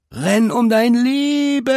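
high, drawn-out warning scream; panicked scream. A narrator's reading. Panicked bear racing past the girl, screaming a warning. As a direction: scream it, stretching the last word long. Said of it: "schrie er".

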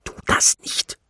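hushed, urgent objection; suppressed, voiceless protest; whispered protest. A narrator's reading. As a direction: plead in a whisper to stop. Hushed protest while being squeezed.

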